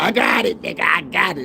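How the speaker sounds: high-pitched, mock-excited bragging; mock boastful outburst. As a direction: crow it out, imitating a show-off. Acting out someone telling everybody.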